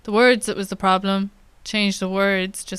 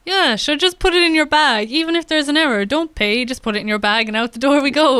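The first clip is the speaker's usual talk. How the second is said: higher, louder, carefree machine voice; breezy careless checkout voice; 5 semitones higher, 4 dB louder than usual. Retold or imitated. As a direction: wave them through casually. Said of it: "he's there saying".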